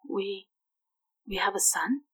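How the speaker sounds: breathless whispered disbelief; stunned whisper. Acted. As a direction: whisper it, barely believing. Stunned at news of son.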